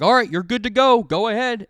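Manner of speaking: high, loud bouncer-style go-ahead; dad as bouncer. Retold or imitated. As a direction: wave the kid in like a club doorman. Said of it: "my dad was like".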